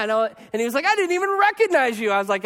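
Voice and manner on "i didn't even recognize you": higher, startled double-take; a fan finally placing the pastor; sudden recognition. Retold or imitated. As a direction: catch yourself mid-thought, amazed.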